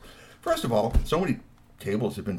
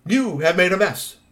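scolding; 8 semitones higher, 6 dB louder than usual. A narrator's reading. Troll girl scolds werewolves.

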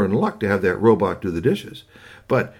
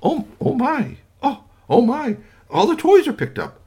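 very high, loud amazed gushing; astonished mother; 13 semitones higher, 5 dB louder than usual. Acted. Mother finding the spotless room, astonished and very high. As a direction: gasp at every tidy corner.

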